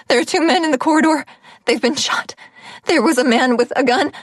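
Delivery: fast, high, breathless panic; panicked. A narrator's reading. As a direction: blurt it out in alarm. Panicked report to guard.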